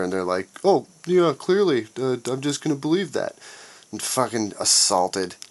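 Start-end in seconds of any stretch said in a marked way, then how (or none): 0.6-3.4 high-pitched credulous imitation; mocking gullible believers. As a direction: mimic someone happily swallowing it, voice pitched up. Mimicking gullible party base agreeing.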